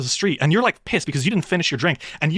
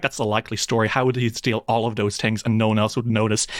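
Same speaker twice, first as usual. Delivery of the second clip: dismissive guard; 7 semitones lower than usual. Acted.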